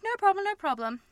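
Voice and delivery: high, bright mock reassurance; lightly voiced eager boyfriend; eager partner. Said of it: "And he's like".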